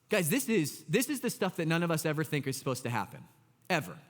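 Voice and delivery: ordinary talk, nothing marked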